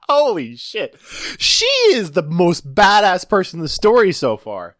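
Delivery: high-pitched, amazed gushing; awed excitement. Real, not acted. Listener reacts to the nameless woman in the story, amazed and excited. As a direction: exclaim it in real, high-voiced awe.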